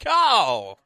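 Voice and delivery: high, loud stab at a name; having a crack. Acted. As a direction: blurt Carl as a hopeful guess. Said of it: "they have a crack at it".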